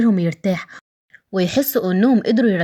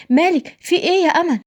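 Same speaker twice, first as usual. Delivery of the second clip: much higher, worried call; alarmed cry; 10 semitones higher than usual. Acted. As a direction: rush to her in a panic. Nareen runs to fallen Amal. (نارين مفزوعة بتنادي على أمل)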